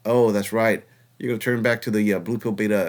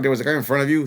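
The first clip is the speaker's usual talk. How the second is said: ordinary talk, nothing marked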